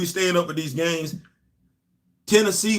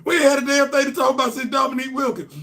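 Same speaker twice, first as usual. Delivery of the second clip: high, fast comic rant; exasperated outburst; 9 semitones higher, 3 dB louder, faster than usual. Real, not acted. Fan griping about Georgia basketball's long drought, exasperated outburst. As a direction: burst out in a high, quick complaint.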